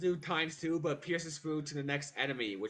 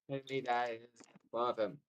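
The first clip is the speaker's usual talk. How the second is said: ordinary talk, nothing marked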